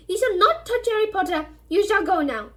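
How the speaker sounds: high, loud, fierce elf command; Dobby ordering Malfoy away. Acted. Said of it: "he said fiercely".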